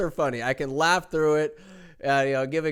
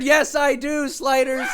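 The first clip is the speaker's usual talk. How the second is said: excited squeal; 13 semitones higher than usual. Retold or imitated. Excited high yelps.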